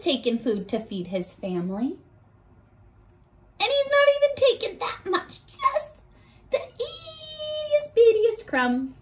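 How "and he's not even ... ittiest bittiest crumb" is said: laughing. Real, not acted. Teacher defending the ant to her class, laughing. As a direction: giggle at how small it is. Laughing about tiny crumb.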